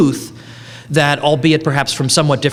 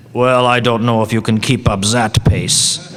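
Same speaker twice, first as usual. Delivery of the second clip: put-on measured German-accented reply; deliberate, heavily accented German English; 3 semitones lower than usual. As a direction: imitate a dry German professor. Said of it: "German accent I can do".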